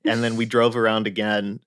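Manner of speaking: normal talking voice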